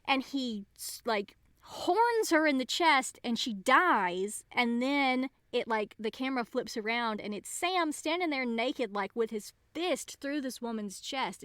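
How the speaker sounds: ordinary talk, nothing marked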